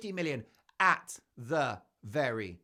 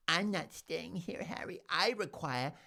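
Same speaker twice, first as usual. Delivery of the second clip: mock diva; 5 semitones higher than usual. Mocking Meghan complaining to Harry, hammed-up diva demand. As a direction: demand luxury like a spoiled duchess, building in volume. Imagines Meghan refusing a cottage.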